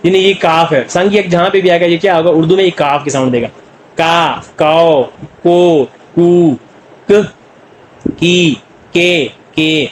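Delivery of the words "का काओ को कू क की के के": "का, काओ, को" with a tense क and no puff of air; slow drill of thin, unaspirated क syllables; tense, thin sound. Shown as a demonstration. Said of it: "tense के साथ भी बतली sound देता है". ("का, काओ, को" में क पतला, बिना हवा के)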